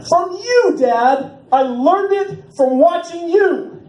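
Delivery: high, loud, slow anguished yell; mock anguished outcry. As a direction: wail it like a hammed-up TV drama. Parodying famous drug commercial line.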